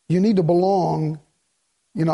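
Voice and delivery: normal talking voice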